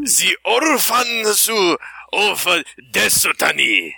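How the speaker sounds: put-on very slow, high Japanese accent; drawn-out samurai movie voice. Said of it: "He's good at that".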